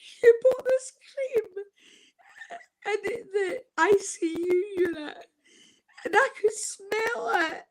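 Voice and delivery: real tears as she recalls the cream; crying. Real, not acted. Telling the audience about her nurse, crying. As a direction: voice breaking high with tears.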